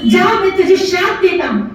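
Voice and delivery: high-pitched, driving angry pronouncement; furious curse. Acted. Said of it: "इतना गुस्से में आ गए". (क्रोध भरे ऊँचे स्वर में श्राप)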